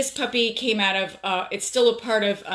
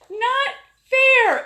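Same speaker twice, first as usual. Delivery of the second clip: very high, loud teary wail on fair; mock crying protest; 7 dB louder than usual. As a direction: whine it like a sulking child. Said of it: "I'm like".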